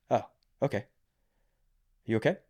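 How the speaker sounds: mild, unbothered calm, barely reacting; imitating a calm Swede who stays unfazed; calm Swedish reply. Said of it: "in the most Swedish way".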